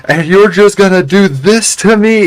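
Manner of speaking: high, loud, strained accusation; father yelling at the girl he's strangling; imitated angry outburst. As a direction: play a father's betrayed fury. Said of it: "like, very angry".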